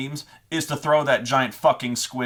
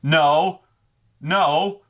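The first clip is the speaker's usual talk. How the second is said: louder, higher mock no; firm mock refusal from the DM; playful loud denial; DM refusing; 5 semitones higher, 5 dB louder than usual. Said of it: "look at his notes and go".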